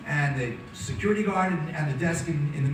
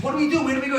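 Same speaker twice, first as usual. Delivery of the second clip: high, frantic questions; panicked questions; 10 semitones higher, much faster than usual. Retold or imitated. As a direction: ask it in a rising panic. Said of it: "I says".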